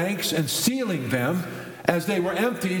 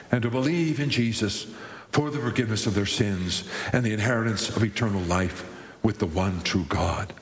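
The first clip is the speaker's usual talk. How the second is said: ordinary delivery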